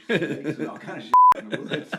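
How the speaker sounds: loud, high-pitched mock alarm; retold protest. City lover refusing the river to his prison union contact, comically alarmed protest.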